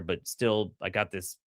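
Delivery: ordinary talk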